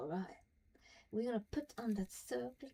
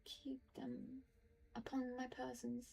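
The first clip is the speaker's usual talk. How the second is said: reading a journal entry aloud, a little higher, measured; diary reading; 3 semitones higher, 4 dB quieter than usual. Acted. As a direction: read it like a private diary, steady and serious. Reads Halsin's diary aloud.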